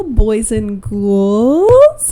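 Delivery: very slow, drawn-out spooky greeting. Acted. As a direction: stretch it out like a horror host. Spooky Halloween greeting opener.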